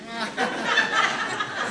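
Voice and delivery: a sudden high, soft laugh; giggling at her own dark joke. Real, not acted. Facilitator laughing at her joke about an old dead cat.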